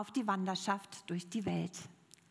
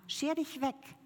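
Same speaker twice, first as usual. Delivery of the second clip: angry dismissal; 7 semitones higher than usual. A narrator's reading. Healed man turning away a blind beggar, angry dismissal. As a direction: send them away with storyteller's mild anger. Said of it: "schrie der Mann es an".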